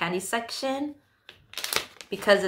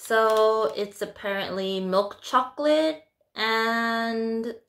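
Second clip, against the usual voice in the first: normal talking voice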